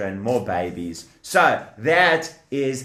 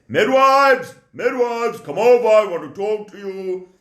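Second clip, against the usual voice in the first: calling out; 5 semitones higher, 3 dB louder than usual. Acted. Pharaoh calling midwives.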